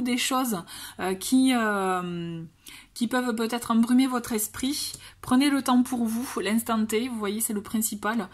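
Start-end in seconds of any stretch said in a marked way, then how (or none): none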